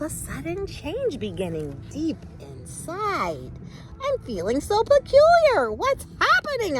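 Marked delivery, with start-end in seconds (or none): 4.0-6.8 high, loud, startled acorn; alarmed cry. Acted. Acorn feels itself sprouting, cries out high and loud. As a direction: cry out, much higher and louder. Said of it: "I cried".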